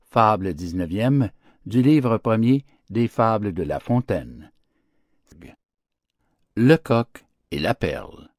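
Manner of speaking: ordinary delivery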